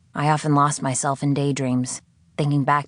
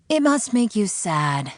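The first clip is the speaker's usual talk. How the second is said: slow, higher, coaxing probe; mock sympathy; 7 semitones higher, 3 dB louder, slower than usual. The voice needling the prisoner about her father, slow coaxing sympathy. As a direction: dangle the guilt gently.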